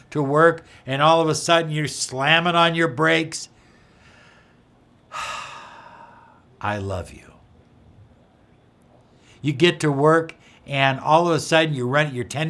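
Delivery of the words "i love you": sigh, then a soft breathy I love you. Shown as a demonstration. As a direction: let the anger go on a sigh.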